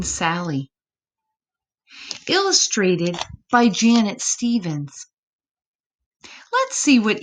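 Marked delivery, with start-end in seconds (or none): none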